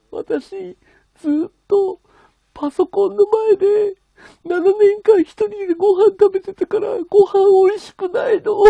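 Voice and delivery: high, loud, slow tearful imitation, choked up; girlfriend sobbing. Streamer imitating girlfriend crying about eating alone, high sobbing voice. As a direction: wail it out like a lonely girl finally understood. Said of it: "ずっと泣いてて".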